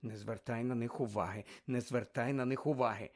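soft, low-pitched reassuring words; quiet reassurance. A narrator's reading. Telling Harry to pay them no attention, quietly reassuring. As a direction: keep it down and soothe.

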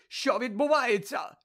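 high loud alarmed shout; loud alarmed shout on seeing Krum. Acted. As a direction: cry out in alarm.

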